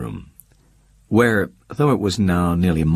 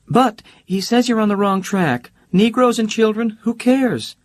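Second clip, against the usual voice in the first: much higher, breezy socialite voice; Holly's bright voice; 12 semitones higher than usual. Acted. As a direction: play Holly teasing and carefree.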